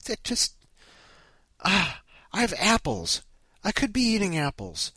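high-pitched, worked-up self-scolding; exasperated rant. Real, not acted. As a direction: berate yourself in rising disbelief, stretching the last questions. Rants at himself about eating popcorn.

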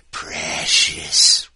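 loud, hissed-out Precious like a ring-obsessed creature; a hint of a ring-crazed creature cooing; creepy Precious voice. Stranger under the underpass clutching the ring, mock creepy coo. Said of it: "some Mexican is going".

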